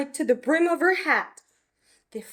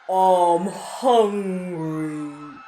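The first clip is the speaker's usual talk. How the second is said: very slow, lower dragon growl; dragon roar; 7 semitones lower, much slower than usual. Acted. Dragon perched on the steeple, lower slow roar. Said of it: "it roared".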